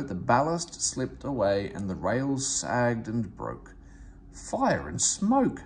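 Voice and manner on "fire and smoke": high, slow dismayed cry; an engine realising disaster; slow, high dismayed exclamation. A narrator's reading. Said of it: "said Thomas".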